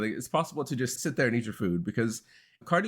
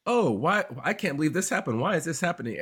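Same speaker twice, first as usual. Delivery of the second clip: imitated wailing disbelief, pitched up; mock dismay; 6 semitones higher than usual. Expat imitating years of reacting to politics, mock dismay.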